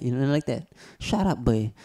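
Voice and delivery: usual speaking voice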